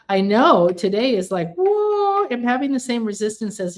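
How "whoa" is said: long, high-pitched "whoa"; overwhelmed whoa. Acted. Host agreeing today's energy is intense, high drawn-out whoa. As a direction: let a whoa soar up high and hold it.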